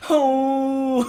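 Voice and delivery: surprised. Shown as a demonstration. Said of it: "you're like".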